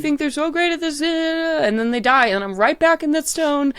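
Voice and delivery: high, dramatic sword voice; weary diva sword complaining; depressive drag queen. Acted. DM voices sentient sword Emily.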